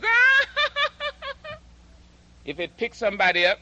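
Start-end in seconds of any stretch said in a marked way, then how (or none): 0.0-1.5 shrill high "Ah!" then forced laugh; high startled cry and laugh. Retold or imitated. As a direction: mimic the maid's nervous laugh. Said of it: "she goes".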